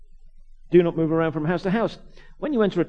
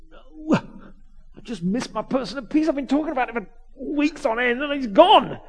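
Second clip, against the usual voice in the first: mock dismay; 8 semitones higher, 3 dB louder than usual. Acting dismay at missing the kid.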